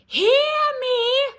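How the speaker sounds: piercing high shriek at the lion; witch's scream. Acted. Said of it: "the witch screamed at Aslan".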